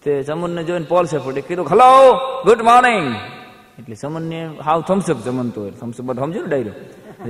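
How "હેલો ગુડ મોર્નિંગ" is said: loud, high cheery foreigner; foreign greeting. Retold or imitated. American guest greeting the neighbour, loud and cheery. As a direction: greet like a bright tourist.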